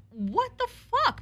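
very high, drawn-out mock outrage; incredulous outburst. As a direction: stretch it out high in disbelief. Retold outraged reaction on phone.

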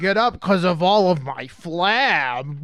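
hammed-up goofy struggling voice; mock helpless oaf. Mocking the movie's pratfalls, mock-helpless goofy voice. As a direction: play a fallen oaf in fake distress. Mimicking fallen fat character.

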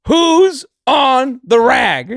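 loud, high, drawn-out announcement; mock calling out. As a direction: holler it slowly across a workplace, word by word. Imagines someone calling round the workplace.

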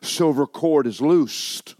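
high, loud, ringing pulpit delivery; full-force preaching. Acted. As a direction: preach it to the back row.

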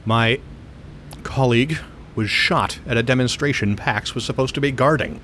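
usual speaking voice

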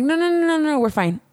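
high, squeaky string of nos; mock panicked refusal. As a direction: yelp the nos up high, imitating herself.

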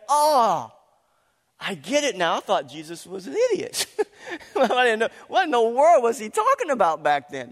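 disciples' realization. Retold or imitated. Preacher voicing the disciples remembering, loud excited realization. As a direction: act out the penny finally dropping. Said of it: "they went back and they went".

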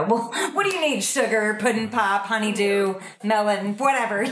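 Southern mom reeling off pet names; Southern grandma. Acted. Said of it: "do the Southern mom, Southern grandma thing".

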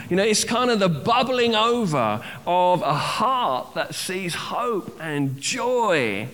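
usual speaking voice

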